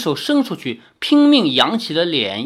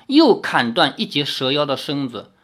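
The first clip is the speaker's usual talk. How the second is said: ordinary delivery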